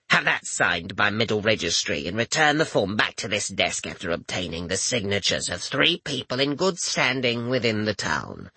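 put-on raspy old clerk rattling off orders; commanding general tone. A narrator's reading. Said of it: "with the sure tone of a general giving commands".

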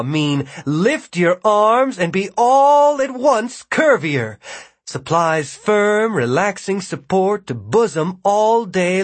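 stretched-out sales announcer; ad read. Acted. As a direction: sell it like a big, gushing advertisement. Advertisement epigraph read aloud.

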